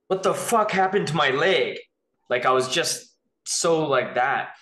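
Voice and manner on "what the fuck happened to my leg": angry outburst. Retold or imitated. Waking patient yelling about his leg, angry. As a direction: snap it out in outrage. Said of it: "like, a bad, bad way".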